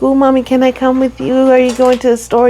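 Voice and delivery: lightly voiced eager child; a hint of a child begging to come along; imitating a child. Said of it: "you babies are like".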